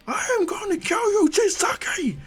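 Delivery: very high-pitched screamed threat; enraged scream. Acted. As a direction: scream it at an enemy in anguish. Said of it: "screaming loud".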